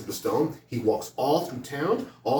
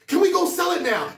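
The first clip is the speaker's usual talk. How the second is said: high, loud, near-singing shout; super excited; 5 dB louder, slower than usual. Acted. Poor son shouting the huge offer to dad, ecstatic. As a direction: yell it out thrilled and slow. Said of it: "This time he's super excited".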